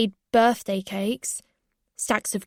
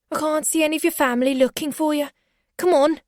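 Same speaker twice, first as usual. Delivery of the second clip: higher, fast pushy man; Jean Jacket voice; 6 semitones higher, faster than usual. A narrator's reading. Jean Jacket pressuring her to leave, fast and pushy. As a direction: hurry her along roughly. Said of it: "said Jean Jacket".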